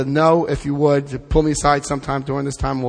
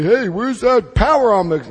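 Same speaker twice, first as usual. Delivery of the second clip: lightly mocked demand, pitched up and swinging; mock complaint; 5 semitones higher than usual. Imitating people who complain to the speaker, mock complaint. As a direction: imitate someone griping. Acted complainers asking for the power.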